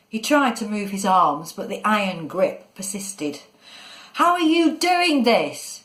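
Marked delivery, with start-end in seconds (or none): 4.1-5.8 high, drawn-out shouted question; alarmed high-pitched call; shouting. A narrator's reading. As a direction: shout it high, demanding an answer. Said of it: "he shouted".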